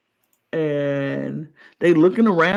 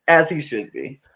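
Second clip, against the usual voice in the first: ordinary talk, nothing marked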